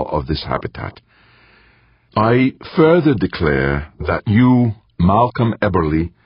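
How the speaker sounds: formal declaration; formal speech. Acted. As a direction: proclaim it like an official. Professor formally declares constitution.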